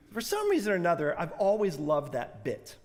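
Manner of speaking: ordinary talk, nothing marked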